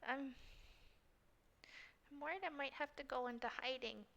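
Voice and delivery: very soft, timid in-character voice; acted worry, barely above a murmur; small and sniffly. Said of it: "gives a small sniffle".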